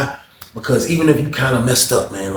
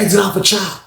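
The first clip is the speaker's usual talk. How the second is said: tag; 9 semitones higher than usual. Retold or imitated. Announcement for chow being called out.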